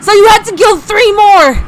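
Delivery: high, loud anguished fury; loud furious wail; crying out. Acted. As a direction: scream your grief at the killer. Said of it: "as he cried".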